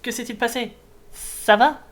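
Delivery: high anxious character voice; worried high voice. Acted. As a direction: call out to the fallen one. Character finds collapsed stranger.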